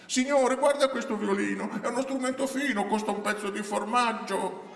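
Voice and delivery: high, sing-song market seller's pitch; brigand woman disguised as vendor, wheedling. Acted. Disguised brigand woman hawks a stolen violin to a passer-by, wheedling high voice. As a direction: coax the buyer in a high character voice.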